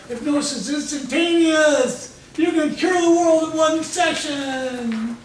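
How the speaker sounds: high, loud showman's claim, last words drawn out; hammed-up shout, stretching into a slow finish; mock grand proclamation. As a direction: proclaim a miracle cure mockingly. Mocks grand claims of hypnosis training.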